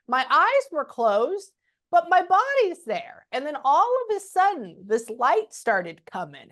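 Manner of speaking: a light imitation of a dying mother; imitating mom's slow voice. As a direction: let her words come slowly, as if half-asleep. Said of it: "This is how it sounds".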